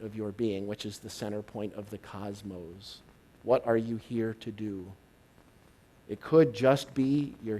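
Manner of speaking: low, soft, unhurried inspirational voice; soft spiritual address. Acted. Speaker guiding the audience inward, in a soft meditative voice. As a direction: speak the truths gently, like a meditation.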